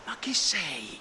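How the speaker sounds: awed whispered question. A narrator's reading. Sailors ask the pirate's name.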